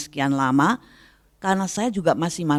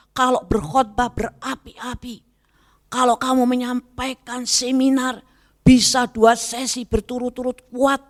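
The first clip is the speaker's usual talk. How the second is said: higher, fervent voice of God speaking to her; raised, lightly acted divine voice; 8 semitones higher than usual. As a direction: speak as God gently rebuking. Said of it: "seperti Tuhan katakan dalam hati saya".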